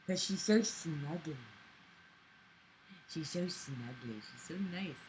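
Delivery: low, soft cooing; tender cooing. Real, not acted. Soft, tender cooing about the cat on her lap. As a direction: murmur fondly over a snuggly pet.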